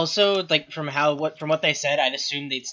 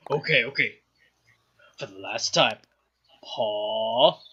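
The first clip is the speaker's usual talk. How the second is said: low, weary trainer giving a command; slightly softer, strained patience; Hrothgar; 5 semitones lower, 4 dB quieter than usual. Acted. Hrothgar teaching a pup at night, low long-suffering voice. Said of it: "Hrothgar sitting late at night with one of the pups".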